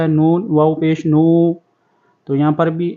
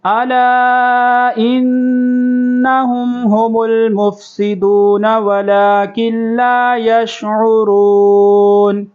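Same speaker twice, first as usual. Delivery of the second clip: slow, higher melodic tajweed chant; Quran recitation; 6 semitones higher, 4 dB louder, much slower than usual. Shown as a demonstration. Teacher recites the verse for students to repeat, in melodic chant. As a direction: recite it melodiously, stretching the long vowels.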